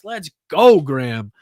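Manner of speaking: loud, rising "let's go" cheer; excited cheer. Real, not acted. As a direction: hype up the long-time member. Cheering a member.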